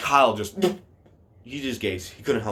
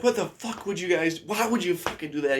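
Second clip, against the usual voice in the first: higher-pitched mock indignation; retold pissed-off protest; 7 semitones higher than usual. Pranked friend demands to know why, retold pissed-off protest. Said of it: "He's all pissed".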